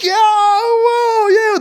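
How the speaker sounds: very high, drawn-out mock whoops; imitated uproarious whooping. Band reacting to the guitarist's cheeky question, imitated whooping. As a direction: imitate a crew cracking up and cheering. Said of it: "They were laughing their heads off".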